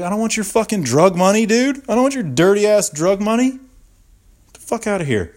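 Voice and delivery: high, loud, scornful outburst; indignant refusal. Retold or imitated. Victim refusing drug money in cop car, indignant. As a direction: throw it back at him in acted outrage.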